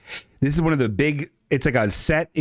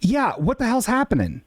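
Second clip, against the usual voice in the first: high, exasperated disbelief; 10 semitones higher than usual. Real, not acted. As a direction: pitch jumps way up in disbelief. Asks caller in confusion.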